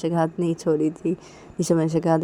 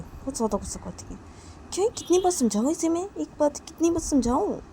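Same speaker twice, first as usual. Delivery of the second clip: muttering to herself; 8 semitones higher, 3 dB quieter than usual. Acted. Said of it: "अपने आप बोलती कि".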